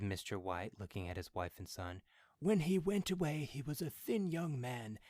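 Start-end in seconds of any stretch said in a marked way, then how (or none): 2.4-5.0 put-on higher old man voice; lightly voiced old father. A narrator's reading.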